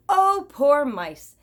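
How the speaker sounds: slow, loud lament; mock pity. A narrator's reading. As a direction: wail it with big storyteller sympathy. Narrator pities the mice.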